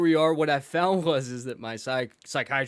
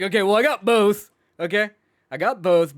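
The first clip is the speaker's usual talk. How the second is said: louder, laughing, retold insistence; hammed-up insistence to the doctor; mock defensive; 5 semitones higher, 6 dB louder than usual. Retelling a defensive reply, laughing.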